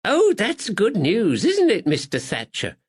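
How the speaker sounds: high, bright-voiced kindly lady; sweet older lady greeting a visitor; Miss Bennett. Spinster greets the constable at the shop, pleasantly high.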